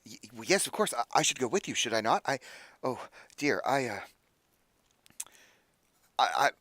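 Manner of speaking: mildly flustered, broken phrases; tripping over thoughts. Acted. Offering to come along to the temple, mildly flustered. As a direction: lose your thread as you agree. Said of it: "he's, like, tripping over his own thoughts".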